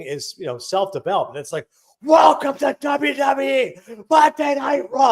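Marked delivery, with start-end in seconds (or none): 2.0-5.1 slow, booming ring-announcer yell, much higher and louder; hammed-up arena shout. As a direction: scream it like opening a live show to a packed arena. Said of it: "Like, it was at that level".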